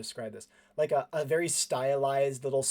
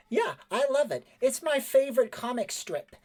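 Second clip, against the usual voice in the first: put-on high-pitched, keen boy voice; enthusiastic boy character; 11 semitones higher, 3 dB louder than usual. Cleven on his favorite comic strip, high boyish enthusiasm. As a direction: gush in a high kid's voice.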